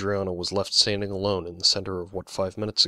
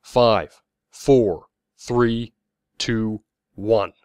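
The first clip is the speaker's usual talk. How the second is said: loud, spaced-out count; crowd countdown; 3 semitones higher, 8 dB louder, much slower than usual. A narrator's reading. Crowd joining the countdown to demolition, loud chant. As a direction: call each number out, building anticipation. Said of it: "The crowd began to join in the countdown".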